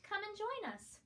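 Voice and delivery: squeaky mice. A narrator's reading. Field mice inviting bunny.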